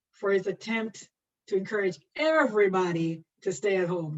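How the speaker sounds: ordinary talk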